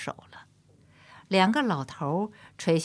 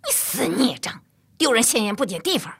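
sharp, higher scolding voice; scolding mother; 5 semitones higher, 5 dB louder than usual. A narrator's reading. Embarrassed mother scolds her son in front of everyone, sharply. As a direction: jab each word at the child. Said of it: "点着他鼻子说".

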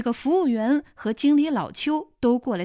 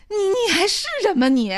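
furious scolding; 7 semitones higher, 5 dB louder than usual. Acted. Mother scolds ungrateful daughter.

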